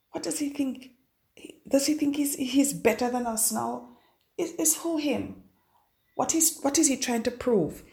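mock the resentful neighbours, voice lifted; bad-minded people. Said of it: "You always have a set of bad-minded people".

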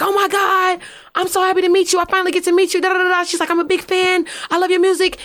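high gushing mock fan voice. Rapper imitating costar Courtney Kim, high gushing fan. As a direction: gush like an excited fan.

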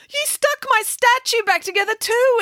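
very high, outraged schoolgirl outburst; shrill exclamation. Acted. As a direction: exclaim it high-pitched, in protest. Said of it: "exclaimed Mirabella".

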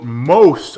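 MOST punched out loud; all-caps emphasis. Acted. Co-host reading an all-caps headline, MOST said loudly. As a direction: hit "most" hard, as if in capitals. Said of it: "all caps".

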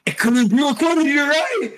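high, laughing, loud burst of realization; excited laughing realization. Real, not acted. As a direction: laugh it out as it clicks.

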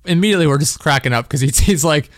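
ordinary talk

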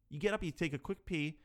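usual speaking voice

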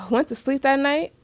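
usual speaking voice

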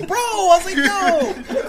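high, very loud protest; hammed-up horrified yell at a friend; outraged mock shout. Protesting kick to friend's head.

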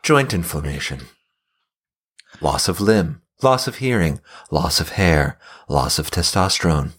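low, steady side-effects narration; deep ad voice listing side effects; Keith David voice. Retold or imitated. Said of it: "the Keith David voice".

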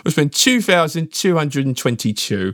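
Richie Benaud attempt. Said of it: "It's better, but a little bit cockney".

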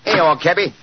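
a Cockney workman calling to the cab driver; Cockney accent. Acted. Holmes in disguise calls to the cabby in Cockney. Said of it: "My Cockney accent".

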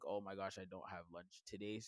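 softly imitating a student; quiet mock student. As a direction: mumble like a sad kid. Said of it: "it's like".

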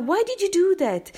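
high-pitched shocked demand. Retold or imitated. Shocked passerby confronting the dog's owner, high and appalled. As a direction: ask it high, as if you can't believe it. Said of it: "I was so shocked".

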